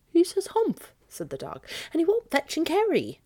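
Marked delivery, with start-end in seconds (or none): 0.1-0.9 dog voice. Acted. 1.9-3.2 dog voice. Acted.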